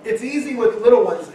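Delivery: ordinary talk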